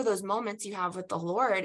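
ordinary talk, nothing marked

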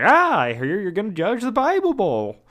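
playful voicing of a chatty neighbor; chatty old man. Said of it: "or he's like".

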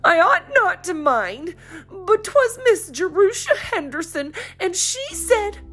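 words pushed through tears; tearful girl. A narrator's reading. Polly confessing to her mother, crying.

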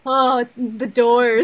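loud, drawn-out gushing cry; exclaiming. Real, not acted. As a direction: groan it out loudly in admiration. Gushing over the door sequence.

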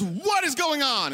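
high, drawn-out indignant mom; storming-in demand. Retold or imitated. Mom storming into the room, mock indignant demand. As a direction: imitate a mother demanding answers. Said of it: "She storms in the room and says".

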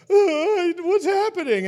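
high, loud startled squeal; mock high-pitched shocked stylist; shocked hairstylist. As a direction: squeak it out, overwhelmed and teary. Hairstylists weeping, voiced by teller.

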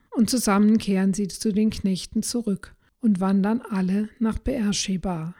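usual speaking voice

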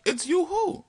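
high, squeaky disbelief; man refusing to believe it's her; incredulous. Retold or imitated. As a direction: push it up in total disbelief.

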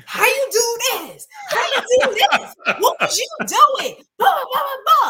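high, loud angry yell at the other driver; Brooklyn-style yelling. Retold or imitated. Woman yelling at the lady who hit her husband's truck, loud and furious. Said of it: "That's that Brooklyn".

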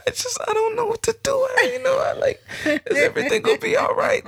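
very high, wailing hammed-up sob; retelling his own panic in a mock wail; mock crying. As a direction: whimper like a scared kid at the clinic. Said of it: "and I was crying".

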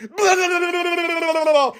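high hammed-up cackling laugh; mock cackle. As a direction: laugh like a goofy morning DJ. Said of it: "cackling morning shows".

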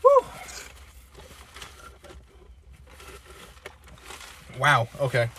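gasping at the spicy heat; reacting to a burning mouth; mouth burning from spicy food. Real, not acted. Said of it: "my nose is running a little bit now".

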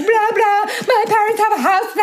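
high-pitched mocking weekender voice; high, mocking boast; mock Hamptons weekender.

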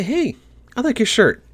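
high, sing-song friendly greeting; sweetly compliment a passerby. Retold or imitated. Gamer showing a friendly NPC greeting, light and upbeat. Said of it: "just friendly tone with them".

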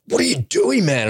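yelling at the guy who pulled out; mock road rage yell. Mock road rage shout at a driver who cut in. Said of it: "I just feel like".

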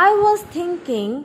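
eager. A narrator's reading. Mother Golden proposes keeping the baby, speaking eagerly. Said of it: "Mother Golden said eagerly".

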